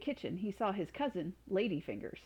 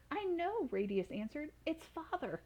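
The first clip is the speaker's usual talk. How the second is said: put-on boy voice; 8 semitones higher than usual. A narrator's reading. Boy tells mother of father.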